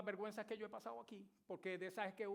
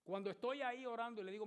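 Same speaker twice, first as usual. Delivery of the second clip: ordinary talk, nothing marked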